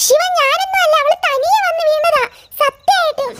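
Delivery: pleading denial. Acted. Child denies blame to brother.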